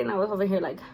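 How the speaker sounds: usual speaking voice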